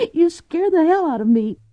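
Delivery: high, tearful outburst; frightened woman sobbing at him; Cordelia crying. A narrator's reading. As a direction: blurt it out through tears. Cordelia through tears.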